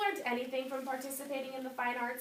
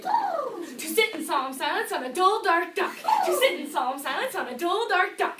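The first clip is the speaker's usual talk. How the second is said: vocal warm-up tongue twister; 4 semitones higher, 6 dB louder than usual. Shown as a demonstration. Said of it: "warming up before audition".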